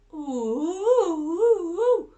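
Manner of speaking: high, loud wolf howl rising. Acted. Imitated howling.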